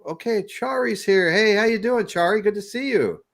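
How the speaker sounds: high, bright welcome call; excited greeting. Real, not acted. Host greeting Chari arriving mid-show, high and excited. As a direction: greet a friend walking in.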